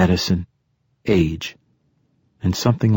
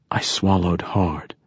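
ordinary delivery, nothing marked

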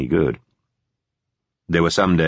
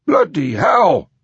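high, loud outraged yell; angry shout; 11 semitones higher, 5 dB louder, much slower than usual. A narrator's reading. Philip reacting to news of sabotage risk, outraged shout. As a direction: bellow it in high-pitched outrage. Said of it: "Phillips shouted".

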